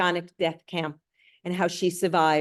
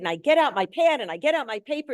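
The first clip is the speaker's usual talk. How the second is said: normal talking voice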